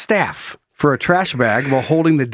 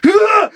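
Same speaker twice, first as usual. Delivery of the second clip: loud, high-pitched acted scream; high mock yell; 5 dB louder than usual. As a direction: let out a dad's attack cry. Imitating the dad's yell.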